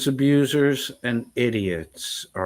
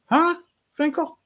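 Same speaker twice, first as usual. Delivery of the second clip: very high mocking "Huh, Finkel?"; taunting high question. Real, not acted. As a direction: jab him with a high taunt.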